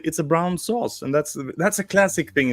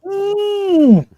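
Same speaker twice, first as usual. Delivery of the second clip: delighted ooh; 9 dB louder than usual. Real, not acted. Greeted with exaggerated welcome.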